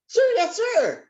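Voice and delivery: very high, squeaky military reply; high-pitched voice. Shown as a demonstration. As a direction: say sir yes sir way up high. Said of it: "that would be a very high-pitched voice".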